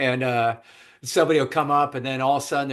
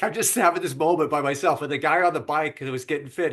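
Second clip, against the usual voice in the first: normal talking voice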